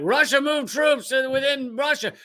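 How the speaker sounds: high, mock-dramatic anchor read; lightly hammed-up news voice. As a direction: parody a breathless newscaster. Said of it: "mainstream media, be like".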